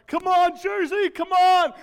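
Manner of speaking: loud, high mock sideline yell; shouted cheering. Uncle too into niece's soccer game, high loud shouting. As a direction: holler encouragement like a carried-away fan. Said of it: "the worst part about it, though, is like".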